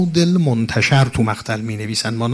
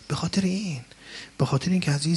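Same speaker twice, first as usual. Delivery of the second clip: whisper; 5 semitones higher, 5 dB quieter, much faster than usual. Real, not acted. Preacher hushes before key point.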